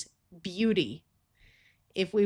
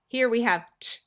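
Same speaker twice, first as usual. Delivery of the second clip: ordinary delivery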